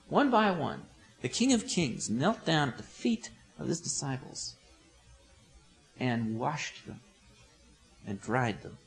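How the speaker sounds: slow, softening, lowering storyteller; hushed narration. A narrator's reading. Preacher retells Jesus washing the disciples' feet, hushed. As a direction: let it sink down to a hush.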